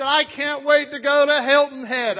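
loud, high, drawn-out anticipation; big, slow, excited shout; eager excitement. Retold or imitated. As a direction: proclaim it at full volume, bursting to go. Retelling his own eager anticipation.

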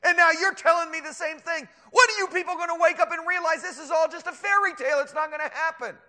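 high, loud, exasperated scoffer; scoffing. Acted. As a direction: berate the gullible believers. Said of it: "That's what the scoffers say".

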